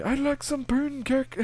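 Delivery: high, slow goofy voice; reading a viewer comment in a goofy high voice; silly read comment. Acted. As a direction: read it as a dopey character, way up high. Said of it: "that's actually a comment".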